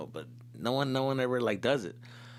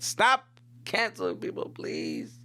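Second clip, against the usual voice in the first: ordinary talk, nothing marked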